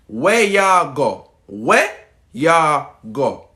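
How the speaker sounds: very slow, word-by-word phrase; accent challenge demo. Shown as a demonstration. Showing viewers real Jamaican speech, very slow demonstration. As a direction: stretch each word out slowly. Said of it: "Accent challenge".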